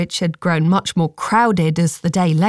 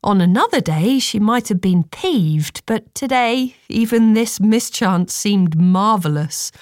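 ordinary delivery

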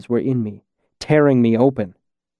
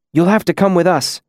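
higher-pitched insistence; insistent rescuer; 6 semitones higher than usual. Acted. Masked man to the freezing kid as horns near, insistent. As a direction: tell them firmly, pitch lifted. Incanus urging hurt kid along.

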